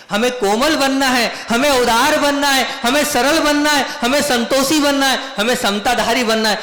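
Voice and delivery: high, insistent litany of wishes; eager pleading list. Acted. Devotees reel off wishes to Maharaj.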